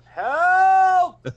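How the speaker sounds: high, loud cry for help; drawn-out, high-pitched shout; acted scream. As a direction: scream out for rescue. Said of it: "I scream out".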